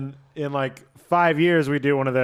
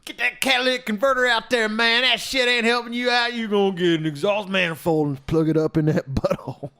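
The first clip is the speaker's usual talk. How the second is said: put-on hillbilly accent; 7 semitones higher than usual. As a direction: play a goofy hillbilly mechanic from the South. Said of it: "you do have a hillbilly sound to you".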